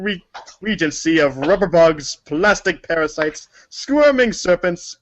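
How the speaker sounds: a blustering old-fashioned colonel, hammed up; hammy old-timey gent. Reading Colonel Sassaker's letter to the pranksters, hammy old-timey bluster. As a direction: boom it out like a pompous old showman.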